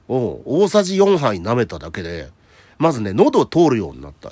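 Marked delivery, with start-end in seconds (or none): none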